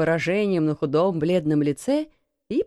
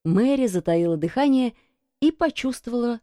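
plain reading voice

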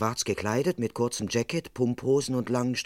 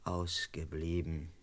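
very slow, much quieter repeat; skeptical echo; 6 semitones lower, 11 dB quieter, much slower than usual. Acted. Constable writes down the butler's excuse, slow skeptical echo. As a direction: echo the excuse while writing, unconvinced.

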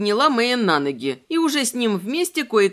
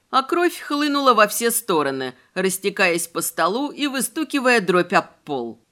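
plain reading voice

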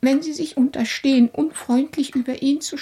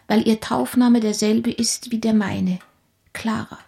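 usual speaking voice

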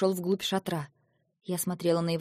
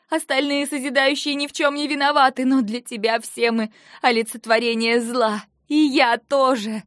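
much higher and louder, strained yelling; hoarse shouting; 6 semitones higher, 7 dB louder than usual. Acted. Eva accusing Lentar of murder, hoarse strained shouting. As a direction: scream the accusation at him. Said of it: "Голос сел словно от крика".

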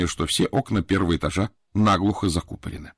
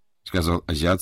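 ordinary delivery

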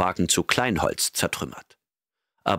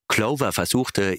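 usual speaking voice

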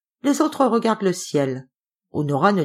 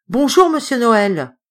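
glad greeting; 5 semitones higher, 5 dB louder than usual. A narrator's reading. Greeting recognised friend.

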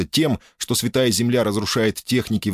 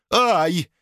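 high sharp yelp of pain; squeal; 10 semitones higher than usual. Acted. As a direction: yelp as if stung. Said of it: "взвизгнув". (визг)

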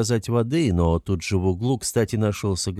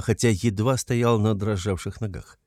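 ordinary delivery

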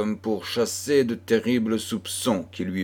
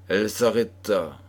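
usual speaking voice

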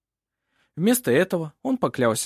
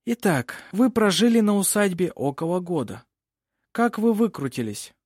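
lightly voiced lawyer, raised pitch; questioning advocate; 6 semitones higher than usual. A narrator's reading. Lawyer asks Винга how she coped alone, questioning. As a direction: lead the witness onward.

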